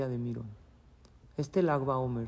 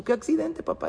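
much higher, innocently baffled; puzzled surprise; 12 semitones higher than usual. Acted. A son who knows nothing of the accident, asking his father in surprise. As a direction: ask as if you have no idea.

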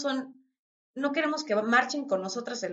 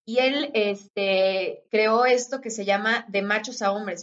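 normal talking voice

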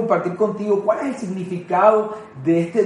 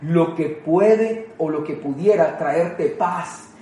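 slow tender quote; slower than usual. Acted. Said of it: "y dice".

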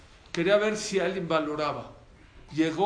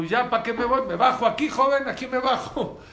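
louder, higher, laughing as he leaves; gleeful exit; 7 semitones higher, 8 dB louder than usual. Acted. Merchant gloating at the driver, laughing loudly. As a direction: gloat and hop off the cart.

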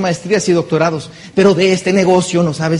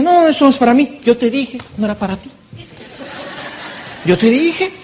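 friend refusing; 7 semitones higher than usual. Retold or imitated. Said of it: "te dice".

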